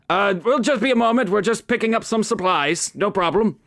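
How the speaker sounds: brushing them off, quick and light; Reginald, quick and breezy. Acted. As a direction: rattle it off lightly. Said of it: "And he says".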